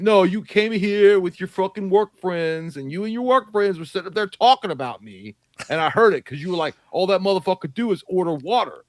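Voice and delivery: heated mock tirade; retold angry accusation. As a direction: play back an aggrieved man's accusation. Retold angry confrontation outside a bar.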